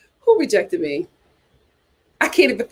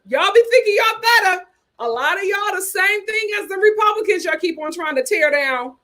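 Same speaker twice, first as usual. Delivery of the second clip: heated rant; 7 semitones higher than usual. Real, not acted.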